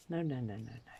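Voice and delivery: ordinary talk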